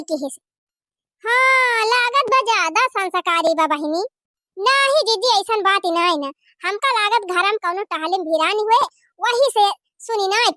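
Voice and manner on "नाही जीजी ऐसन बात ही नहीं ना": normal talking voice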